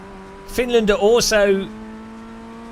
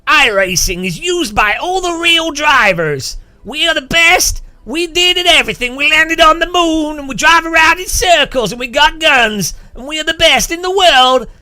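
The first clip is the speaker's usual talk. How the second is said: loud, much higher, boasting shouts like a cocky ad; brash American corporate hype; 9 semitones higher, 5 dB louder than usual. Retold or imitated. Mocking an American sim-racing promo video, loud boastful hype. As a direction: oversell it like a chest-thumping American promo. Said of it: "iRacing video will be like".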